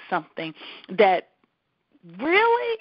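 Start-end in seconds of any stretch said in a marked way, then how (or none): 2.2-2.8 incredulous. Real, not acted. Disbelief at the request.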